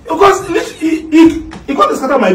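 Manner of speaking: high, laughing stammer. Real, not acted. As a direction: try to speak through real laughter. Amused after sorry remark, laughing.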